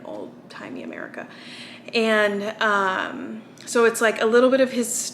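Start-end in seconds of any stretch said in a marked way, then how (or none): 0.0-1.3 soft unvoiced whisper, trailing off; whispered aside. Vlogger shrugs off where the character is from, in a whisper. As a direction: breathe it like a vague secret aside. Vague aside about the setting.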